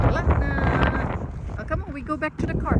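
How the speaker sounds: high, fast coaxing call; calling the dog. Real, not acted. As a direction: coax the dog back eagerly.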